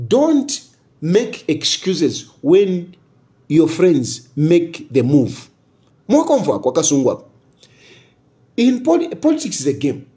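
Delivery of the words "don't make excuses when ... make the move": slow and weighty, each word spaced; slow proverb quote. Retold or imitated. Quoting a political saying to listeners, slow and deliberate. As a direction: lay down the saying slowly like a proverb. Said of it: "and they say".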